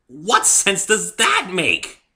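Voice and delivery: exasperated near-shout; almost shouting. A narrator's reading. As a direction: demand an answer. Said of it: "almost shouting".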